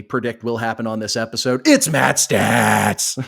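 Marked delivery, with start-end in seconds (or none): 1.7-3.2 announcer intro. Acted.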